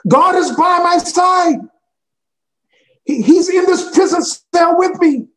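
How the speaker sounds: very high and loud, ringing; almost crying out with joy; excited. Retold or imitated. Pastor in restaurant, soaring excited cry. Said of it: "he was quite excited when he said it".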